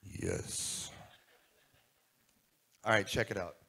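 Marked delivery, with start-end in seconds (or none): 0.0-0.9 hushed, breathed-out radio DJ murmur; seventies FM radio voice. Retold or imitated. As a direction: imitate a late-night FM host, all breath. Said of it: "in his F M radio voice from the seventies".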